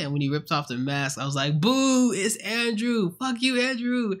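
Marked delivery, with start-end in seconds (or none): 1.6-4.2 loud, high jeering boo; mock booing. Fan booing Andrew in the theater, high loud jeer. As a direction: jeer at the screen like a disappointed fan.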